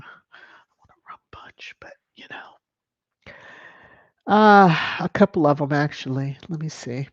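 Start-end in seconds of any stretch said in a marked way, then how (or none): 0.0-2.5 soft, low, nearly voiceless confession; hushed aside. Real, not acted. Host confiding a rum punch craving to viewers, in a whisper. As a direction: trail off into a whisper.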